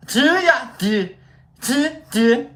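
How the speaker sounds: "To ya" high and loud with an Aussie vowel; Australian "to". Shown as a demonstration. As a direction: practise "To" and "do" loudly in mock Australian. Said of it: "They do the to".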